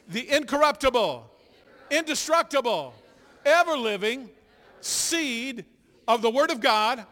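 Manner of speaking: loud, emphatic confession of faith; rally the congregation with a loud declaration; bold declaration. Acted. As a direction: proclaim it boldly to the whole room. Leads group confession of faith.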